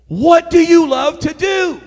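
high, loud, drawn out; insistent shout. Real, not acted. Preacher overriding an excuse, insistent shouted question. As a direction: hammer each word slowly at full voice. Repeats question over the objection.

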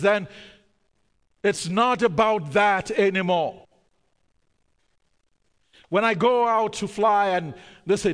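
loud, high preaching cadence; full-force preaching. Acted. Pastor preaching consequences to the congregation, full force. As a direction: preach it out with fervor.

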